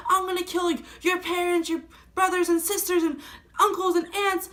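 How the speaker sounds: imitating an enraged father, high-pitched yelling; screaming threats. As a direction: yell threats as the furious dad, voice pushed high. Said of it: "screaming in my face".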